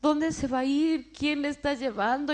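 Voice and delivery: retold shout.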